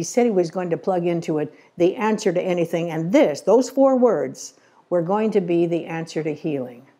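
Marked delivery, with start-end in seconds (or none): 3.4-4.5 slow and emphatic, higher and louder on "those four words". Real, not acted. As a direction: lean hard into "those four words", each word spaced out. Preacher stresses the key phrase.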